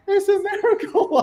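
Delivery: high laughing "It's a miracle". Retold or imitated. Son opening the cheque, laughing high. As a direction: burst out with laughter.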